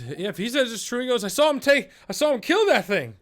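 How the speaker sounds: high, loud eager villager; a witness rushing to back the story; excited townsperson. Acted. Villager vouching for the hero to the crowd, high and eager.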